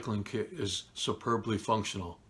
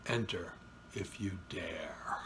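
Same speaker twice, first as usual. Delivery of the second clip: slow, soft, eerie whisper; spooky whisper; 4 semitones lower, 8 dB quieter, slower than usual. Acted. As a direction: whisper it like a horror host. Spooky invitation in horror intro.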